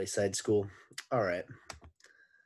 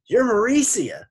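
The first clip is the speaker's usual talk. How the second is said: high, loud Scottish lilt; Scottish gentleman; 13 dB louder than usual. A narrator's reading. Boy speaks in borrowed voice, loud Scottish gentleman. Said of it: "sounded like the gentleman from Scotland".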